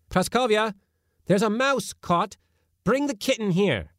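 high, loud call to the next room. A narrator's reading. Master calling the housekeeper to fetch the kitten, high and loud. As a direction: summon her from afar.

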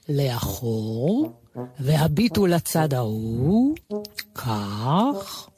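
low, very slow, sweet-talking camel; camel judge. Acted. Camel lines up the animals as judge, slow and sweet. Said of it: "אמר הגמל".

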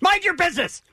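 high mock yell that cuts in; cranky imitated outburst; yelled old man. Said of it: "He just kept yelling that".

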